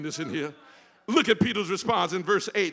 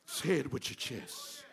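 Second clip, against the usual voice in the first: much softer and lower, almost to himself; quiet echo; 4 semitones lower, 6 dB quieter than usual. Shown as a demonstration. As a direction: drop it to a hush after the shout.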